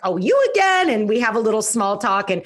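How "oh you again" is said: high, bright mock surprise; a playful pitched-up oh, you again; teasing surprise. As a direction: tease an old acquaintance. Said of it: "she's like".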